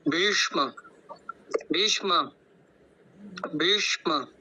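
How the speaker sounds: भीष्म with long ई and retroflex ष, full म; pronunciation demo. Shown as a demonstration. Teacher models भीष्म for students. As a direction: repeat भीष्म carefully, each sound clear. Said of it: "कुछ इस प्रकार होगा".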